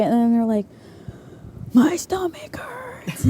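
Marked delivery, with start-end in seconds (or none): 1.7-3.2 high, slow whispered whine; whimpering whisper. Retold or imitated. Girl complaining to her leader after junk food, high whispered whine. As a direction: whisper it like a sick kid at bedtime.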